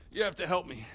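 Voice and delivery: ordinary talk, nothing marked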